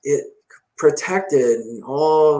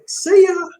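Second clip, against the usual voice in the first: high-pitched, laughing send-off; breezy mock goodbye; 4 dB louder than usual. Mimicked breezy goodbye after shaking it off.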